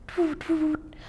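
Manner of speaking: a gentle parting owl call; soft owl hoot. Acted. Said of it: "answered the owl".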